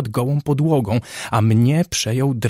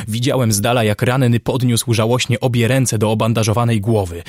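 usual speaking voice

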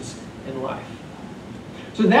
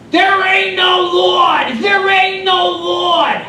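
loud, slow, high mock shout; exasperated atheist hollering back; angry neighbor yell; 10 dB louder, much slower than usual. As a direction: bellow it across the street at her.

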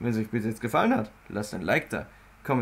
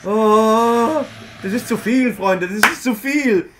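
being chased; 9 semitones higher, 8 dB louder, slower than usual. Real, not acted. Fleeing the enemies, shouting.

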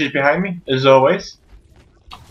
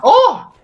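high cut-in "oh"; startled yelp; 4 dB louder than usual. Real, not acted. Gamer about to die, high startled yelp.

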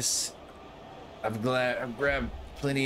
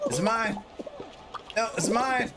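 possessive whining; 11 semitones higher than usual. Real, not acted. Fighting over penguin.

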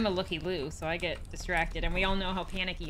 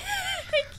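high squealing laugh; shrieking laugh. Real, not acted.

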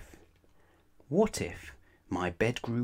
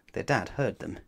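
plain reading voice

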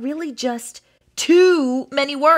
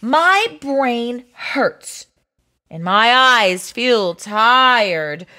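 very slow, drawn-out whine; weary moaning; much slower than usual. Acted. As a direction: drag each word as if drained. Worn out by reading.

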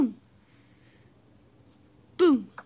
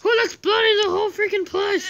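laughing outcry; 8 semitones higher, 6 dB louder than usual. Real, not acted. World exploding around her.

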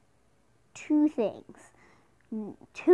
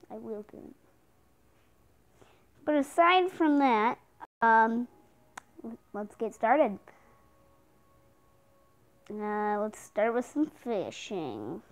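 normal talking voice

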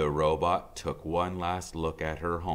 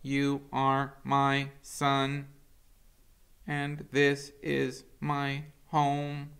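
higher, very slow robot voice; tender robot mother; 10 semitones higher, 4 dB louder, much slower than usual. Acted. Roz to her son, slow and tender. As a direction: reassure him slowly as the robot. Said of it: "said Roz".

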